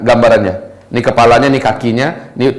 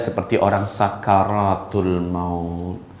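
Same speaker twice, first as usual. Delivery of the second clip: slow, much softer, trailing down low; hushed deathly description; 5 semitones lower, 8 dB quieter, much slower than usual. Retold or imitated. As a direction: lower and slow the words, fading away on the last. Menggambarkan orang sekarat, diperlambat. (pelan, lirih dan rendah, makin menghilang)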